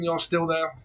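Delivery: normal talking voice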